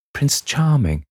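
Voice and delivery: high, dreamy name said with longing; high, sighing lovestruck answer; adoring Sybil. A narrator's reading. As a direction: breathe his title like a girl in love. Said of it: "she answered".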